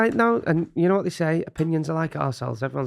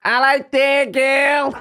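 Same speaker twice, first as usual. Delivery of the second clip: high, loud, very slow camp Northern drawl; high loud camp Geordie greeting; Danny impression; 11 semitones higher, 6 dB louder, much slower than usual. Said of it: "I can do an impression of Danny".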